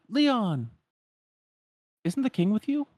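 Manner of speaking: high, carried call across the room. Retold or imitated. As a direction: call out to a friend you spotted. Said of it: "calls out".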